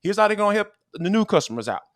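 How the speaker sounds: high, pushy sponsor pitch to listeners; podcast host hyping a sponsor deal, energetic ad read; upbeat ad read. Acted. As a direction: sell the betting offer like a radio spot.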